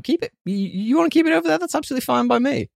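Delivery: high teasing keep-away voice; mock recoil. Real, not acted. Keep covid over there.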